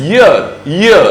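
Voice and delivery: "year" with a distinct initial y; correct y-sound. Shown as a demonstration. Teacher repeating the right pronunciation, "year" begun with y. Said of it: "इसका सही pronunciation जो है वो है".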